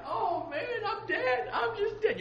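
very high, squealed panic; comic falsetto-high dread; mock despair. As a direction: wail it as if doomed, hammed up. Acting out Obadiah's despair.

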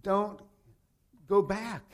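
ordinary talk

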